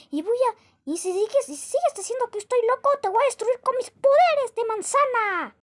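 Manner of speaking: high, loud unhinged villain shouting; shrill-free but wild high-pitched boasting threats; crazed apple. Acted. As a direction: rant like a mad apple who thinks he rules the world. Crazy apple threatens other apple.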